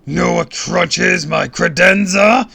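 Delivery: high, loud, slow villain boom. A narrator's reading. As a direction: boom it like a cartoon brute. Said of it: "he boomed".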